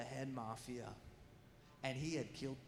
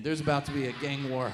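mock alarm; 3 semitones lower, 12 dB louder than usual. Fearing a gang war.

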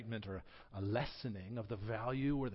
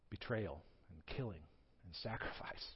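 softer, lower, near-whispered question; hushed; 8 semitones lower, 12 dB quieter than usual. Real, not acted. Preacher asking the congregation, hushed and grave. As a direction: drop to a hush for the weight of it. Solemn question about the sacrifice.

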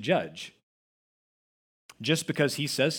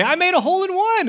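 much higher and louder, bursting with pride; proud brag; 10 dB louder, faster than usual. Retold or imitated. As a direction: announce it like big news. Bragging call to golf buddies.

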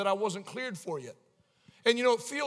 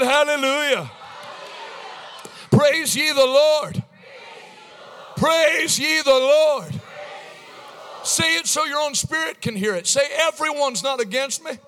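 raised, loud revival preacher delivery; full-force preaching; 8 semitones higher, 10 dB louder than usual. Real, not acted. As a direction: drive it home to the congregation.

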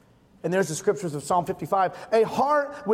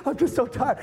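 higher-pitched mock tired whine; mock crying; 5 semitones higher than usual. Husband mimicking his exhausted wife in bed, mock crying. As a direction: imitate a worn-out tearful complaint, pitched up. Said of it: "she's, like, crying".

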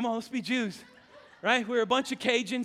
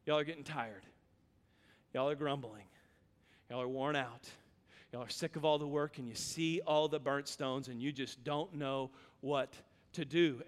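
lower, softer tired voice; weary and low; 8 semitones lower, 9 dB quieter than usual. Acted. Preacher voicing the builders' weariness, quiet and low. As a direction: sound as worn out as they are.

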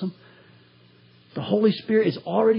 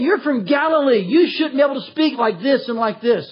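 astonished crowd; 6 semitones higher than usual. Retold or imitated. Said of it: "they're saying".

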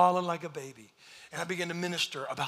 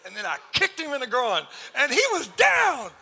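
louder and higher, laughing through a fake brag; mock tough-guy shout; 9 semitones higher, 8 dB louder than usual. Pastor jokingly bragging to the congregation about a fight, laughing shout.

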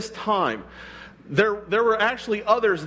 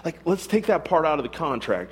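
ordinary delivery

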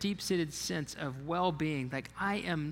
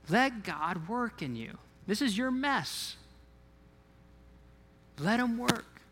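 friend's urging; 5 semitones higher than usual. Retold or imitated. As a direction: plead with him not to give up. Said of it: "a friend of mine just saying".

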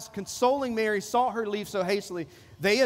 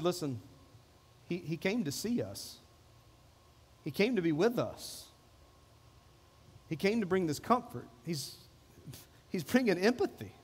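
tender consoling; 3 semitones lower, 6 dB quieter than usual. Acted. Martha gently consoles Mary.

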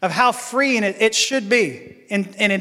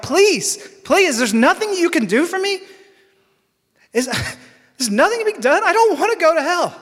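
much higher, pleading and begging; desperate pleading; 9 semitones higher than usual. Retold or imitated. A scared teen begs the camp pastor, desperate pleading. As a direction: beg as if your soul depends on it.

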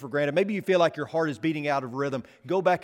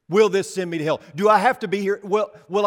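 louder mock of a nervous churchgoer; preacher mimics anxious members, louder; mocking worried question; 5 semitones higher, 8 dB louder than usual. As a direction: throw the question back with a mocking edge. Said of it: "you know how I don't like the question".

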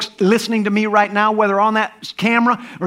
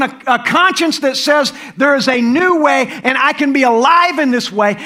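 ordinary talk, nothing marked

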